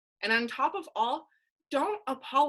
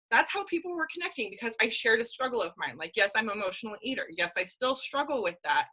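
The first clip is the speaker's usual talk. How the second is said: ordinary delivery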